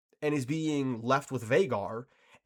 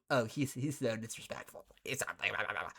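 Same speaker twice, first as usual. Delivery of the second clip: lower, much softer mocking imitation; mock sneering; 5 semitones lower, 8 dB quieter than usual. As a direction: mimic the online crowd dismissively. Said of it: "people are going".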